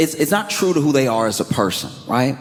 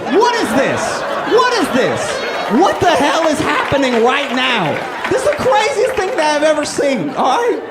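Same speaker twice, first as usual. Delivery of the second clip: incredulous rant; 9 semitones higher than usual. Retold or imitated. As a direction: yell like you've never seen anything so crazy. Ranting about weird handshake.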